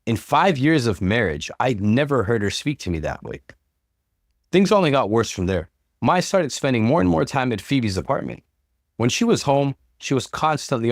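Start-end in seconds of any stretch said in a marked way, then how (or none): none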